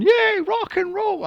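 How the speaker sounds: high, loud, slow mock cheer; hammed-up squeal of a cheering crowd. The school kids cheering the metal rocker, in a high, loud mock cheer. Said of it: "they're going".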